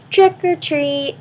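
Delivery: high, slow sing-song door call; trick-or-treat call. Acted. Kids at the door, high slow sing-song call.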